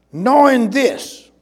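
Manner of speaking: measured reading with long pauses between short phrases; slow reading. Real, not acted. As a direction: read it out slowly, phrase by phrase. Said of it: "I'll try to read it slowly".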